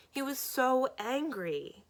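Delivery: high, drawn-out upset wail, understated; slow, distressed emphasis to mom; upset and close to tears; tearful upset. A narrator's reading. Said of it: "between the words and the tears".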